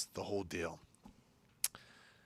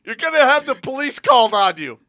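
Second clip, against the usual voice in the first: scolding mom; 14 semitones higher, 13 dB louder than usual. Retold or imitated. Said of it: "and she goes".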